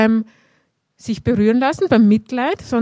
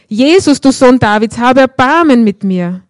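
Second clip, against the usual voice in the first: loud calling out; 2 semitones higher, 8 dB louder than usual. A narrator's reading. Blind beggar calls out to Jesus for mercy, loud. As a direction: cry out to Jesus across the street. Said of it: "begann er laut zu rufen".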